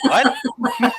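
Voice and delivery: high squeal of laughing disbelief; laughing what. Real, not acted. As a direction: shriek it through laughter. Laughing disbelief at gift card.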